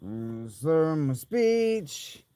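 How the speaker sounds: ordinary talk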